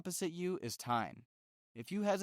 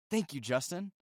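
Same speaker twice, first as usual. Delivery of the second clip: lightly voiced curious friend, a bit higher; eager listener voice; 3 semitones higher than usual. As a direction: play an impressed friend talking to Justin, only slightly changed voice. Acted friend thanking Justin.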